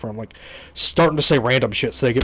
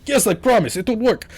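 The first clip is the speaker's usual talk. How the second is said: put-on a light Russian accent, pitched high; mock Russian-accented promise, lightly done; 8 semitones higher than usual. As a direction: mimic the agent with a half-hearted Russian accent. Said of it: "Why is he Russian?".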